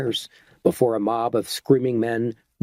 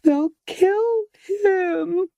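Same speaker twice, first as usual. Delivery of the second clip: even higher, very slow and piercing; unbearably high-pitched; much slower than usual. A narrator's reading. Esmeralda repeats her scream about her lover, highest pitch. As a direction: hold the pitch at the very top, near breaking. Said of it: "almost unbearably high-pitched voice".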